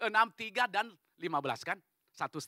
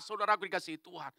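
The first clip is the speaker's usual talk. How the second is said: usual speaking voice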